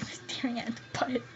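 whispered embarrassed confession; sheepish whispered aside. Real, not acted. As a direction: drop to a whisper for the awkward part.

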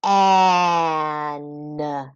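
"K-an" with a lone k, a gap, then drawn-out an; sounding out. Shown as a demonstration. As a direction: tap out "K-an" sound by sound. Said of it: "finger tap the sounds in each syllable".

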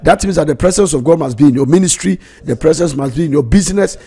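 high, loud, stretched preaching; fervent preaching. Acted. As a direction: preach it out with full fire. Preacher at full force.

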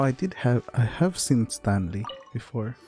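ordinary talk

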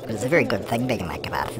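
normal talking voice

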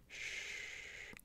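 long, very soft "shh"; calm them with a sustained hush; gentle, breath-only shushing; soothing shush. Acted. Calming an overwhelmed mate.

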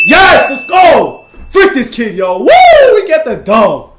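very loud, high-pitched celebration; ecstatic victory shout. Real, not acted. Gamer celebrating the win over a rival, ecstatic yelling. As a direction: scream it out with a big whoop.

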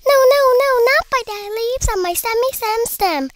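high, squeaky refusal; squeaking. A narrator's reading. First pea shooter refusing the imp, squeaking. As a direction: squeak it out, starting loud. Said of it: "squeaked the second little pea shooter".

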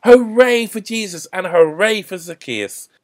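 loud, high hooray; cheering. A narrator's reading. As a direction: cheer for them with the crowd. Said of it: "Then someone cheered".

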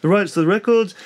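lightly put-on, uncertain British accent. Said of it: "don't know what accent this is".

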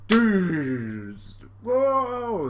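loud impact noise then high whoa; explosive, hammed-up sound effect; imitated crash. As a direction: voice a cartoon crash and yell.

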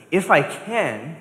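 Jesus answers doubting father, indignant. Retold or imitated. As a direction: throw the doubt back at him. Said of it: "Jesus was indignant".

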